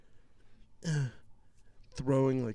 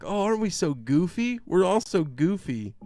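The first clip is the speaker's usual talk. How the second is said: mock goofy; 8 semitones higher, 7 dB louder than usual. Mocking the class laughing along.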